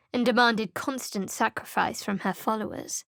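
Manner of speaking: normal talking voice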